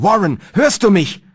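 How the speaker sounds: high, loud shout to someone below; calling down. A narrator's reading. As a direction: call out urgently for a reply. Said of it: "Wieder rief ich nach unten".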